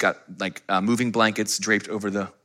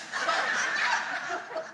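breathy laugh; 11 dB quieter than usual. Real, not acted. Laughing at the same pants.

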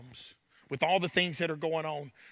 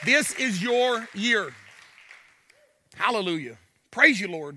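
preaching; 4 semitones higher, 5 dB louder than usual. Acted. Opening call to praise.

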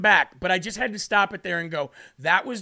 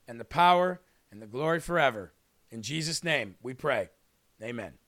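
prayer; 3 semitones lower than usual. Real, not acted.

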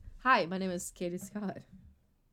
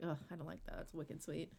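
soft disgusted aside; 4 semitones lower, 18 dB quieter than usual. Retold or imitated. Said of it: "been like".

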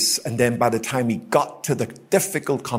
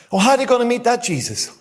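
higher and fast; preaching question; 10 semitones higher, faster than usual. Real, not acted. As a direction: fire the question at the crowd. Rhetorical question from pulpit.

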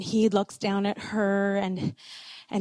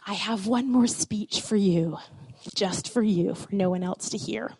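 soft, hushed, a little lower; husband's soft retold murmur to his bride; retold whisper; 4 semitones lower, 7 dB quieter than usual. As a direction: murmur it close, as if into her ear. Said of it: "whispers into her ear and says".

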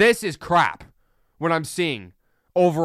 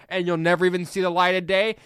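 ordinary talk, nothing marked

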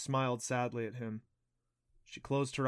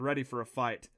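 ordinary delivery, nothing marked

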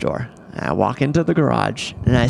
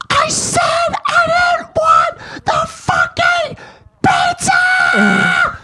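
furious shout; 5 dB louder, much slower than usual. Retold or imitated. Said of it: "And she says".

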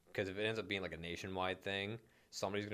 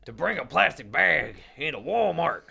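loud, slow, outraged Texan; indignant Texan; 9 semitones higher, 12 dB louder than usual. Pretend. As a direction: belt it out like an offended Texan. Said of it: "a state like Texas and be like".

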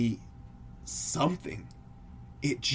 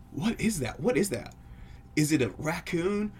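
higher-pitched, mildly panicked questions; shaken alarm; 6 semitones higher than usual. Acted. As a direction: act out a frightened couple asking. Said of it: "My wife and I are shaking".

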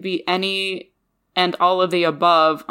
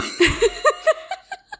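high-pitched laughter; real high laugh trailing away. Real, not acted. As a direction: burst into a high giggle. Laughs at own remark.

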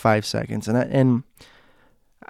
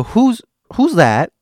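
higher, slow, louder frightened ask; scared question; 10 semitones higher, 6 dB louder, slower than usual. Retold or imitated. Scared girl asks mom who's there. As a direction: ask it eyes shut, scared. Scared, eyes closed, asking.

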